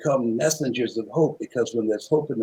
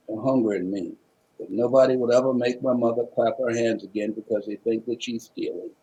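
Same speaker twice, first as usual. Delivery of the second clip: usual speaking voice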